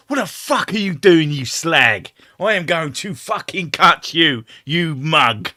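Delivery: high, loud angry London geezer rant; angry London accent. Shown as a demonstration. Showing how she sounds when very angry, loud London accent. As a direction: snarl it out in a furious London accent. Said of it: "I go quite London".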